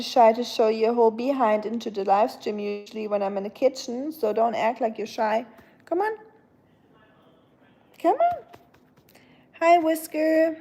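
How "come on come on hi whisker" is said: high coaxing pet call; calling the cat. Real, not acted. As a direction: lift the voice way up to lure the cat.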